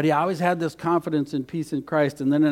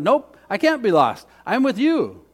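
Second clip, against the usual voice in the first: put-on confident little boy; 6 semitones higher, 6 dB louder than usual. Confident small boy replies.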